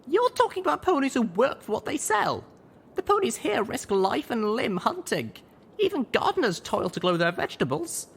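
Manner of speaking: put-on high, arguing young mare voice; heated young mare. A narrator's reading. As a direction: press the point, rising indignation.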